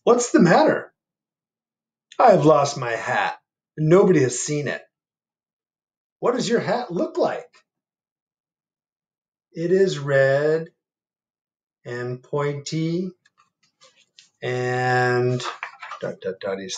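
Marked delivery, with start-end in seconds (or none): 0.0-0.9 high, louder, concerned; deer voice. Acted. Deer asking the sad bear, high and concerned. 2.2-15.4 high, slow, plaintive bear. Acted. Bear asking the animals for his hat, high and slow.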